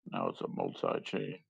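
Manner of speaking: ordinary talk, nothing marked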